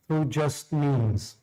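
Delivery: usual speaking voice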